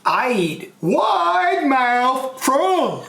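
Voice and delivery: slow, high, drawn-out snake reply; hammed-up storybook snake, very slow; snake character. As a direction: stretch each word out long, pitched high. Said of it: "He goes".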